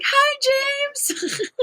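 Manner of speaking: very high, nervous fangirl greeting; tiny high-pitched star-struck hello; starstruck hi. Retold or imitated. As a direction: squeak it out like a nerdy fan. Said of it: "I felt like such a nerd".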